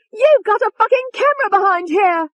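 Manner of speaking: very high, loud outraged scream; full-out high-pitched rage. A narrator's reading. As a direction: shriek the discovery in fury. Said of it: "Tony screamed".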